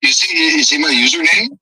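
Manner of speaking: usual speaking voice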